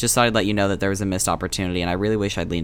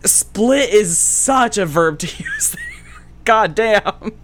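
laughing; 10 semitones higher, 5 dB louder, slower than usual. Real, not acted. Laughing at own remark.

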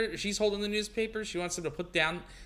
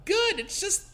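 much higher sweet maid voice; Typhoid Mary voice; 3 dB louder, slower than usual. Acted. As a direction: chirp it in her high voice. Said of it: "that was Typhoid Mary talking".

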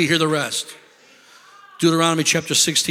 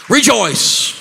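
louder, brighter rejoice; glad rejoice; 3 semitones higher, 4 dB louder than usual. Shown as a demonstration. Showing teens how, loud joyful rejoice. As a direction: say rejoice with real joy. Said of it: "Make them rejoice".

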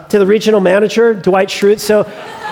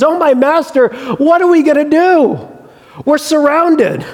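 panicked servant's voice, pitched much higher; freaking out; 6 semitones higher than usual. Retold or imitated. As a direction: blurt it out in alarm, voice shooting up high. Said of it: "He freaks out".

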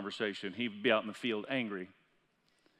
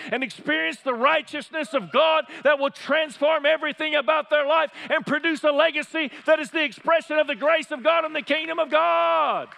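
loud, high, fervent sermon climax; preaching at full force; 13 semitones higher, 9 dB louder than usual. Acted. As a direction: drive it home to the congregation.

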